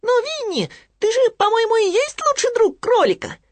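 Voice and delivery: high squeaky piglet, musing; thoughtful Piglet. Acted. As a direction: wonder aloud in a tiny high voice. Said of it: "задумчиво сказал Хрюня". (задумчивый писклявый Хрюня)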